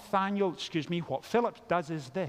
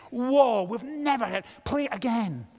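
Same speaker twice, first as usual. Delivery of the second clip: higher, amazed outburst; excited teen; 5 semitones higher, 3 dB louder than usual. Pretend. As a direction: act out a thrilled music fan. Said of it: "you would get excited and you think".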